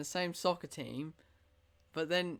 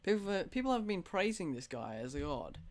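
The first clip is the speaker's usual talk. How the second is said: usual speaking voice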